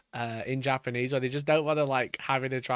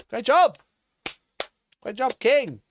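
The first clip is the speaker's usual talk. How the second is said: loud praise; 7 semitones higher, 6 dB louder than usual. Real, not acted. Mock praise to the painter.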